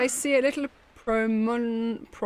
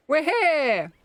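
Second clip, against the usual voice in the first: excited; 6 semitones higher, 5 dB louder than usual. Acted. Arriving at new island.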